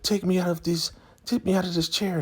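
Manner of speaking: high-pitched imitated demand; mock demanding woman. Elder Mother ordering Zane to free her, high mock demand. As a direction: put on a high voice demanding release.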